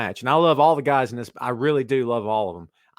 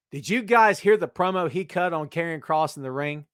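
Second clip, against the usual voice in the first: normal talking voice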